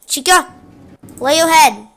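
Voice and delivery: ordinary talk, nothing marked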